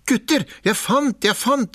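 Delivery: high and eager; thrilled to show his find; excited call. A narrator's reading. Askeladden calling his brothers over, high and eager. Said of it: "sa han".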